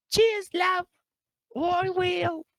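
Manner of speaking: put-on a cheeky, squeaky Australian accent, half-botched; Toni Storm Australian accent. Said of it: "I can't do an Australian accent that well".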